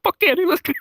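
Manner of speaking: high-pitched hyped cheer; excited, high mock cheer; hyped-up retold reaction. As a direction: act out being pumped to go. Acted excited reaction in story.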